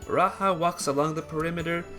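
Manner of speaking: plain reading voice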